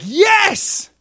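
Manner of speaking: very high, loud, elated shout; happy cheer. Acted. God seeing his people win, overjoyed shout. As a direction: burst out cheering. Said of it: "the happy heart of God getting happy".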